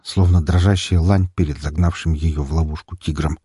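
ordinary delivery

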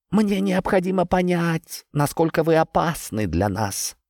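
talking tree. Acted. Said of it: "Голос дерева стал громче, а тон его мне показался угрожающим". (говорящее дерево, угрожающим тоном)

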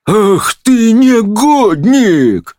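high, drawn-out indignant cry; judge's shrieked rebuke; outraged outburst. A narrator's reading. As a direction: explode at the scoundrel, stretching it out. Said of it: "выпалил доктор Корпус".

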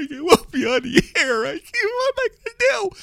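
high-pitched hammed-up wailing; mock crying. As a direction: whine it out like a fake crybaby. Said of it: "People are crying, right?".

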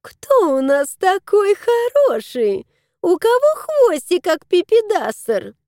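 very high, sing-song cooing; baby talk. Acted. Lady baby-talking the cat on her lap. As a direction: gush like over a baby.

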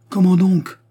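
high, loud, heated interjection; furious interruption. A narrator's reading. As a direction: cut in sharply, voice up. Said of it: "interrompit Raymond en tambourinant avec fureur". (interruption vive, haute et forte)

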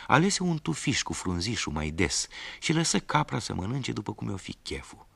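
usual speaking voice

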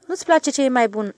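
a mild reproach; reproachful. A narrator's reading. The stationmaster chides the switchman over chicken, mild reproach. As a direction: gently scold a picky eater. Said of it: "zise cu reproș".

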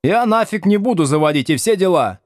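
high, defiant schoolboy pirate; lightly voiced cheeky ringleader; mock pirate defiance; hoarse pirate voice. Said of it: "с хриплым пиратским голосом".

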